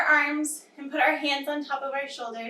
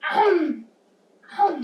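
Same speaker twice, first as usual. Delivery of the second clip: loud pretend bites, higher in pitch; big chomps; 4 semitones higher, 4 dB louder than usual. Teacher miming bites for children as the caterpillar, playful loud chomp. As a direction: chomp down twice with gusto. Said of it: "We're gonna take two big bites".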